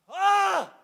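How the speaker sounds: high mock yell of a shot putter; imitated throwing yell. Dad imitates son's first throwing yell, high mock shout. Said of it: "So he goes".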